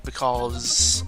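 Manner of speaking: ordinary talk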